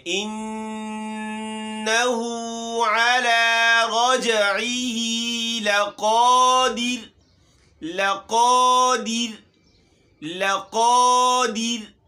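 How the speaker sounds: tajweed recitation. Shown as a demonstration. Teacher recites the verse aloud for the children. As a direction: chant it out slowly and fully.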